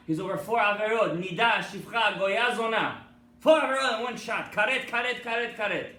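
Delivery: loud, high hammering list; fiery preaching. Real, not acted. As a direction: pound each word home. Preaching at full force.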